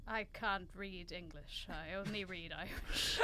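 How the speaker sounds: slow, soft Irish brogue; a lightly put-on Irish lilt; Irish accent. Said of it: "that was a very good Irish accent".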